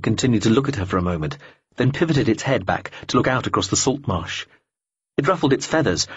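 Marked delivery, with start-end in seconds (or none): none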